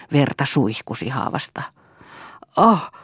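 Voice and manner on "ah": short, higher and louder cry. A narrator's reading. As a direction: cry out briefly, pitched up. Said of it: "huusi Toini".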